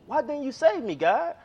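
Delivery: drowned man complaining to God that he wasn't saved; pleading complaint. Acted. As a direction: demand an answer from God.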